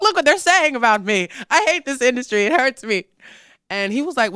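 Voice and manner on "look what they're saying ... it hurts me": high, loud hurt complaint; upset outburst. Retold or imitated. Singer shows boyfriend the tweet, higher and louder. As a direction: vent it to him, louder. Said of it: "I was like".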